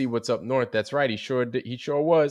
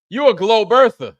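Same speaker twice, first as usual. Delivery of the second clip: quoting Chicago Cubby Bear; 9 semitones higher, 9 dB louder than usual. Retold or imitated. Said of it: "Chicago Cubby Bear was like".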